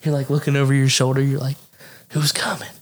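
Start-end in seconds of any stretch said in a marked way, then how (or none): 2.1-2.7 hushed, quick whisper; mock furtive. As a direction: imitate sneaking a nervous check behind you. Acting out furtive phone scrolling at work.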